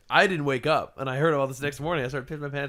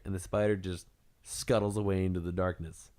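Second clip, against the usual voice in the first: ordinary delivery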